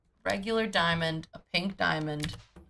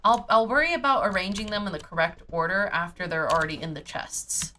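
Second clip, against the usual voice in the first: ordinary talk, nothing marked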